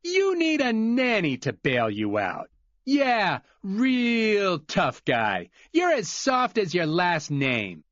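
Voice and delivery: high, drawn-out mocking jeer; taunting Glenn. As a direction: belittle him with scorn.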